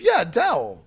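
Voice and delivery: high, loud, obvious-answer voice; imitating Waka. Waka confirming his word to the players, insistent. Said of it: "He goes".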